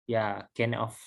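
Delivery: usual speaking voice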